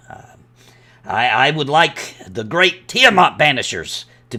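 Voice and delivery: dwarf promoter with swooping emphasis; Rylan. Acted. Dwarf pitching a deadly contest to an adventurer, showy and confident. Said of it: "there's a male dwarf standing there".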